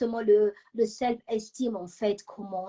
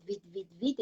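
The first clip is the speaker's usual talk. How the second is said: ordinary talk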